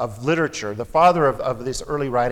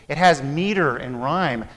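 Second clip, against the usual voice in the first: ordinary delivery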